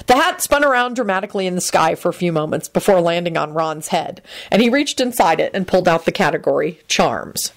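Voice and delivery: usual speaking voice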